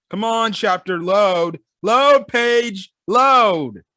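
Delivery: exasperated calling out at a stuck page; impatient urging. Real, not acted. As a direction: build to a loud, pleading "load". Urging a stalled page to load.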